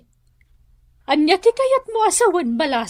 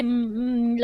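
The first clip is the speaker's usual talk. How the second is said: soft choked sob; mother holding back tears over her son; tearful whimper; 2 semitones lower, 4 dB quieter than usual. Acted. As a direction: let a small sob slip out.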